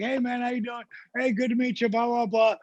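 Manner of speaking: high, breezy hello to a fan; upbeat acted pleasantries; friendly retold greeting. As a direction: toss off a high-pitched greeting.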